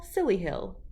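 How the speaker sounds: ordinary delivery, nothing marked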